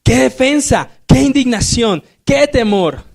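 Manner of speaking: teacher shows the right way, with real exclamation; exclamatory reading. Shown as a demonstration. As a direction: cry out each line in amazement. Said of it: "cómo lo leemos".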